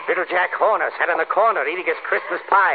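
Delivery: building from gleeful to shrieking high; rising manic frenzy. Acted. Killer advances, rising frenzy.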